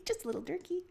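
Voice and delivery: giggling through the words; laughing teasingly. Real, not acted. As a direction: tease him while laughing. Laughing tease.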